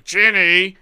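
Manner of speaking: loud, high holler of a name; mock scream. Mimicking a co-host screaming at a movie screening, loud mock scream. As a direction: scream it like some jackass in the audience. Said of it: "jackass screaming".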